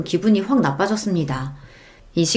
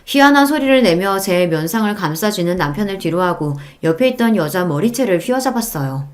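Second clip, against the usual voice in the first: usual speaking voice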